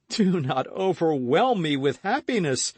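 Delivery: high, loud lover's outburst; overflowing with mock joy; high ecstatic cry of a suitor; rapturous cry. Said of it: "cried D'Artagnan".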